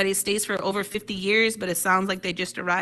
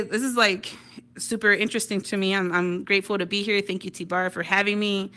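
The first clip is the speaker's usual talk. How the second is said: ordinary talk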